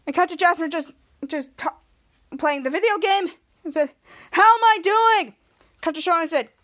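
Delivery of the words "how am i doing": slow, high, loud question; loud over headphones. Acted. Gamer asking friends over headphones, loudly. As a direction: call out as if unable to hear yourself.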